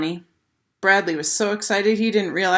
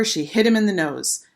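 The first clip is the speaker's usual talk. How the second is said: plain reading voice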